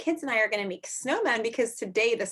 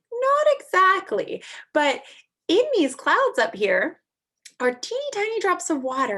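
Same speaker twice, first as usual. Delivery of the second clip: high, louder answer to the snowman; excited explaining; 8 semitones higher, 5 dB louder than usual. Acted. Teacher answering Frosty the snowman, high and excited. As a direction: sell it to the little snowman, bright and eager.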